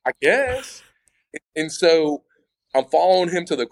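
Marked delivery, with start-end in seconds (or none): 0.1-0.8 put-on high, unsure South Carolina-free shrug of a kid; an unsure, much higher "I guess" like a lost new kid. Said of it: "I was like".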